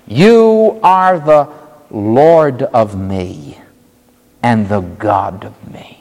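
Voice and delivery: drawn-out literal translation; slow literal reading. Shown as a demonstration. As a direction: spell out each word of the Greek. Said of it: "Thomas said to him".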